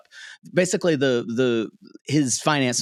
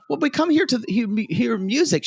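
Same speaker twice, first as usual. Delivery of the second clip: higher, fast, prim lady voice; prim society ladies; 12 semitones higher than usual. Retold or imitated. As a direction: sniff like proper opera patrons. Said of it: "they're like".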